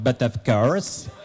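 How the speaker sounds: slow, hammed-up French accent; bad French accent. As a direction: do the worst French accent, drawn out. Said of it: "It was the worst French accent".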